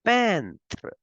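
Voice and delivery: Peintre said slowly and clearly in French; French peintre repeated. Shown as a demonstration. Teacher repeats French word for class. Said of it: "we say".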